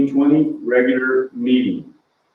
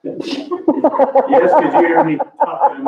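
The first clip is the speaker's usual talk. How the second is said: breathless after walking the streets; out of breath, half laughing; 13 semitones higher, 3 dB louder, much slower than usual. Real, not acted. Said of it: "I'm out of breath and sweaty".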